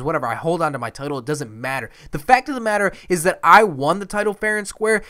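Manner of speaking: ranting heel promo, emphatic; indignant wrestling champion. Pretend. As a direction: protest like a cheated champion cutting a promo.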